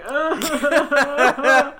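high, loud laughing groan; a giddy overwhelmed groan; laughing ugh. Retold or imitated. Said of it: "I was like".

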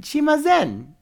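put-on high, rising Japanese accent. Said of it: "your little Japanese accent".

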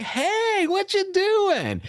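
very high, slow, goofy dog voice; squeaky happy pup saying hi; dog's voice. Retold or imitated. Jumping Labrador greeting the fallen runner, high slow goofy voice. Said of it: "he's like".

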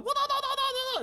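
very high squeaky wah noises; imitated game hit sounds. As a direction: mimic rapid fighting-game blows. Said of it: "all you heard was".

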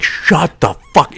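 mock exasperated snap, slowed for effect; impatient. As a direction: act out losing patience. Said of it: "I was so fucking impatient".

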